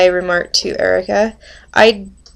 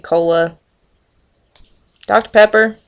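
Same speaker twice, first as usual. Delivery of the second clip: ordinary talk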